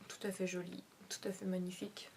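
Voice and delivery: soft breathy whisper. Real, not acted. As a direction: whisper it admiringly. Whispered praise.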